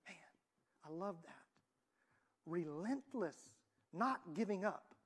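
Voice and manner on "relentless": Relentless drawn out slowly; slow emphasis. Shown as a demonstration. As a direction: stretch out Relentless.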